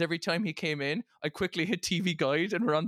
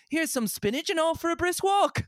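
prim parent voice; 13 semitones higher, 3 dB louder than usual. Retold or imitated. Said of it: "it was like".

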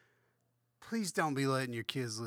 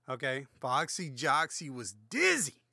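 usual speaking voice

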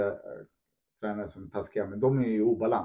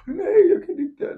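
much higher and slow, a whiny give-up; mock despair; 4 dB louder, slower than usual. Mocking fragile people who give up: whiny high voice. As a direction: play someone who quits at the smallest obstacle. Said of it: "det blir så här".

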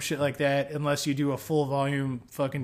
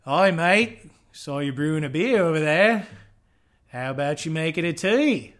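loud Aussie greeting with swooping inflections; mock Australian accent; 4 dB louder than usual. Homebrewer playing an Aussie chatting to a mate, mock Australian accent. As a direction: do a hammed-up, uncertain Australian accent. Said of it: "if that's a good Australian".

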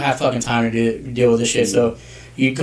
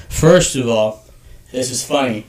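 usual speaking voice

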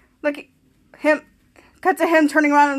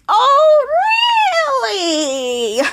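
mock group disbelief; 10 semitones higher, much slower than usual. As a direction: stretch it out like a whole group's exaggerated reaction. Group retold reacting in chorus.

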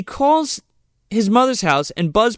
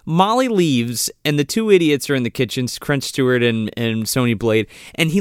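usual speaking voice

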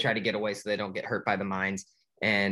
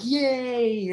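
much higher, a bright little cheer; 13 semitones higher, 4 dB louder than usual. Retold or imitated. Tank fish celebrating Nemo's escape, cheering. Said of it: "And they're like".

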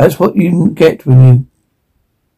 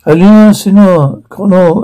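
slow mock Spanish-accented greeting; lightly faked Spanish accent, drawn out; put-on Spanish accent; 4 semitones higher, slower than usual. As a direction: imitate a hammed-up Spanish accent. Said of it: "you don't need to resort".

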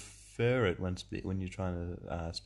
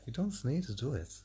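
higher, put-on Irish accent; 5 semitones higher than usual. Co-host objecting to the German voice, Irish accent. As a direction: protest in a mock Irish accent. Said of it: "I'm gonna have an Irish accent".

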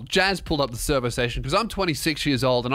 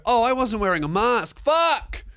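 retold dawning dismay, jumping to a high cry; exasperated realisation; 6 semitones higher than usual. Man realising he forgot his mask, exasperated outburst. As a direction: re-enact the penny dropping, pitch leaping on the curse.

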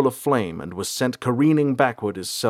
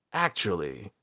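softer, strained croak; weak, croaky interjection; 3 semitones lower, 5 dB quieter than usual. A narrator's reading. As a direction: croak it out from under a weight. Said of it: "Ranger John croaked".

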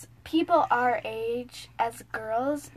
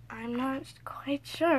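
slow soft whisper; 4 dB quieter, slower than usual. Real, not acted. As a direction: breathe it out in a whisper. Whispered reply.

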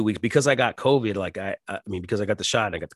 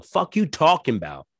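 retold outburst; 6 semitones higher than usual. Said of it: "looking at the screen going".

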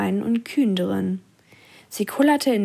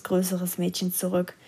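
ordinary delivery, nothing marked

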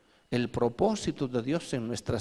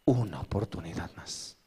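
mournful Samson; 4 semitones lower, 5 dB quieter than usual. Retold or imitated. Preacher voicing weeping Samson, low and mournful. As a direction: plead softly and sadly for one more chance. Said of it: "Y decía".

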